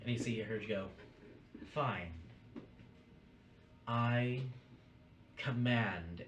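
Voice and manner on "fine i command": drawn out with pauses, louder on "command"; lightly voiced, deliberate order; commanding spectral figure. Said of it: "as she says the word command".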